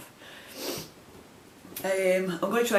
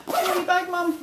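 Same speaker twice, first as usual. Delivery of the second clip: put-on much higher, louder little-girl voice; daughter's voice; 12 semitones higher, 4 dB louder, faster than usual. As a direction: squeak it up like the daughter asking. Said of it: "Went, my, my daughter Claire".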